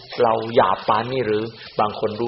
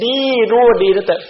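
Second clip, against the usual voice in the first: mock lazy whiner; 11 semitones higher, 5 dB louder than usual. Voicing a lazy person's excuse.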